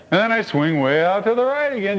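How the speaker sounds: normal talking voice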